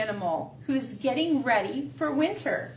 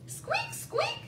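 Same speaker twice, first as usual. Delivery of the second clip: very high, soft squeak; mouse squeak; 7 dB quieter than usual. Acted. Teacher voicing Mouse in the story, very high squeak. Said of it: "said Mouse".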